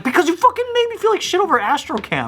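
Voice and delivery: high, mock-indignant yell; mock yell at mom. Son mock-yelling his grievance at his mom, high and indignant. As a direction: yell it back at mom in jest. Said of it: "I was like".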